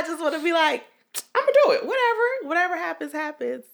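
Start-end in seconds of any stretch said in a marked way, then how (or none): 1.3-3.6 high-pitched, airy throwing up of hands; carefree abandon. Acted. Responsible one imagining taking more risks, carefree. Voices the carefree self she wants.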